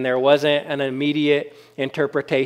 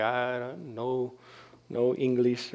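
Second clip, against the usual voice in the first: very soft, slow, halting mock reply; mock non-English speaker; 2 semitones lower, 11 dB quieter than usual. Non-English speaker answering an amazed churchgoer, mock halting and soft. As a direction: play a hesitant beginner in English, hushed and slow.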